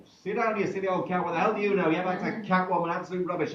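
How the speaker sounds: loud, high indignant heckling; uproar. Acted. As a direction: shout her down in outrage.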